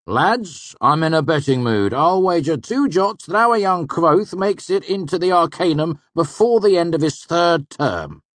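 hearty and loud, egging his friends on; Manet, jovial old student. A narrator's reading. Manet offers friends a wager, jovial and loud.

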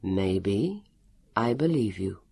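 low, measured voice; calm interrogation. A narrator's reading. Elio to his captive, calm and measured.

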